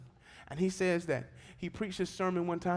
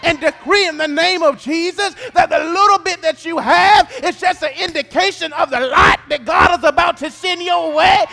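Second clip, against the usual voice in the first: rising, much louder sung preaching cadence over music; full-force preaching; 11 semitones higher, 18 dB louder than usual. Acted. Pastor preaching to the congregation, sung and shouted.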